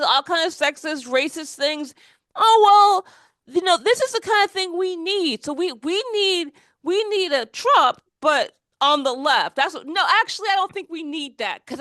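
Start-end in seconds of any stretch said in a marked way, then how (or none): 2.4-9.6 higher-pitched parody of an eager supporter; mock-earnest, higher voice; mocking excuse-maker. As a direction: mimic a naive fan making the case, pitched up. Mimicking left excusing the candidate.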